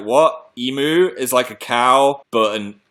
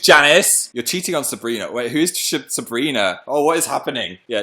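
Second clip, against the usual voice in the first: ordinary talk, nothing marked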